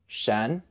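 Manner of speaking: Shen said quietly, sliding upward; rising tone on shen. Shown as a demonstration. Teacher showing the rising tone of 什. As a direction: let shen rise. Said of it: "with a rising tone".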